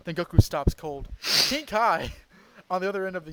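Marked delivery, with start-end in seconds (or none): none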